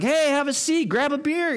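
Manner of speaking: hammed-up carefree gamer buddy; laid-back welcome. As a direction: invite him in, totally unbothered. Relaxed guy gaming.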